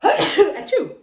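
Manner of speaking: sudden loud sneeze; big real achoo. Real, not acted. Sneeze, then sorry about that.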